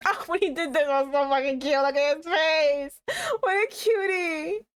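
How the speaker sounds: high, squealing fangirl gush. Real, not acted. Gushing at the character's face on screen, high squealing. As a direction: squeal over how cute he is.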